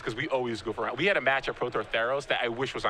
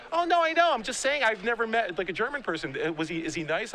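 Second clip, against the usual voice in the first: mom brushing off her son, higher and eager; imitating his mom; 7 semitones higher than usual. Mom defending her question to her son, higher voice.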